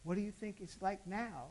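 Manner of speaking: soft altar-call voice to a quiet church; hushed preaching. Real, not acted. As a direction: draw the listeners in close, gently.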